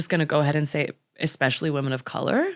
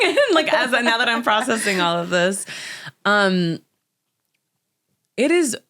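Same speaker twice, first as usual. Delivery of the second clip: laughing through the words, pitch jumping higher; 7 semitones higher, 3 dB louder than usual. Real, not acted. Guest laughing at her sudden epiphany mid-podcast, laughing. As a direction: let the laugh spill into the sentence.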